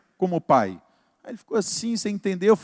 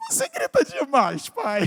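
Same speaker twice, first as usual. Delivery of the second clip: high mocking daughter breaking into laughter; ironic, laughing; 8 semitones higher than usual. Daughter mocking dad about his yelling, ironic and laughing. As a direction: tease him in a high voice, then crack up. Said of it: "o lado irônico".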